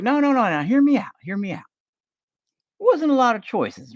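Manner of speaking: hammed-up grandpa pleading to be heard; protesting grandpa. Grandpa defending himself to granddaughter, high insistent old man voice.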